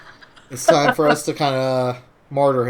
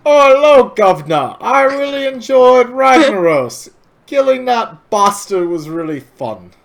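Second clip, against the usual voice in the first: much higher, louder mock British gentleman; hammed-up British accent; 10 semitones higher, 9 dB louder than usual. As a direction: ham up a posh British accent for a laugh. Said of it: "do an impression of my accent".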